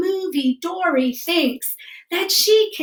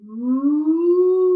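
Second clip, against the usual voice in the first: whale sound. Shown as a demonstration. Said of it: "use your hands".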